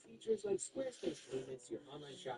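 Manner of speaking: upbeat sponsor read with big pitch swings. Acted. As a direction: sell it like an excited ad host.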